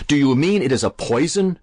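high-pitched, horrified question; horrified cry. A narrator's reading. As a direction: cry it out in horror at the idea. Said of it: "cried Alan, very much horrified".

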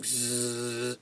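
soft buzzed "Zzz" of a sleeper; snoring buzz. A narrator's reading. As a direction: mimic cartoon snoozing, low and quiet.

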